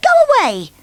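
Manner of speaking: high and loud; bossy shout. Acted. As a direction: shoo him away. Bossy sends Muddle off.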